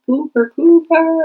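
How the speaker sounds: loud, high, drawn-out call; re-enacted yell. Calling the dog who wouldn't come back, imitated yell. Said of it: "And I'm yelling".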